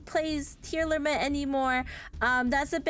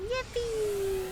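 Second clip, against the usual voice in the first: high little cheer; a tiny high-pitched cheer of thanks; excited yippee; 9 semitones higher than usual. Real, not acted. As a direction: squeal with delight. Cheering hype train.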